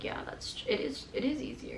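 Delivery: very soft, trailing near-whisper; quiet admission. Retold or imitated. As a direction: admit it under your breath. Retold reluctant admission to therapist.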